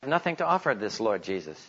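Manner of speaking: ordinary talk, nothing marked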